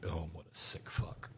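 hushed disgusted whisper. Real, not acted. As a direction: breathe it out in disgust.

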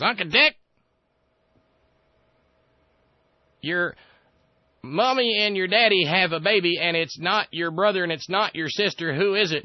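put-on a smug kid showing off a riddle; mock Dear Leader. The president quizzing Dick Cheney, in a high loud childish mock voice. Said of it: "he says".

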